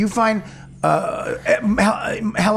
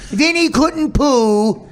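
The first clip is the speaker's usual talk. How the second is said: put-on slow, higher, louder cooing baby talk; mock doggy voice; 6 semitones higher, 4 dB louder, much slower than usual. Teasing the roommate, slow cooing dog voice. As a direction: coo it out slow like talking to a puppy. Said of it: "He couldn't poo".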